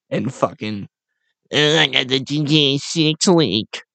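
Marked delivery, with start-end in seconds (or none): 1.5-3.8 put-on dopey nerd voice; mock a nerd bragging about a scoop; mock internet nerd. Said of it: "these fucking nerds".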